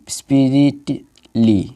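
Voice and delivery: "স্পিরিটলি" slow in three parts, spi-ri-t, li; slow teaching model of "স্পিরিটলি"; split into parts. Shown as a demonstration. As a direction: draw out each part of "স্পিরিটলি". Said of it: "এরম তিনভাবে উচ্চারণ করতে পারবো".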